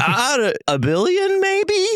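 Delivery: clueless founder guessing a price; mock unsure guess. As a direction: toss out a number like a wild guess. Mimicking guessing a valuation.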